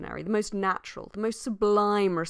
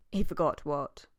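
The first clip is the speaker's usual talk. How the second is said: usual speaking voice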